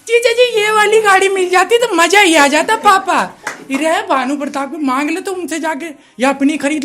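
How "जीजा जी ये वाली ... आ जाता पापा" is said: put-on high, loud whiny girly plea; spoilt daughter. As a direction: whine like a pampered girl. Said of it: "Bhanu Pratap की बेटी साध्वी". (पापा से गाड़ी माँगती बिगड़ी बेटी की ऊँची, तेज़ नकल)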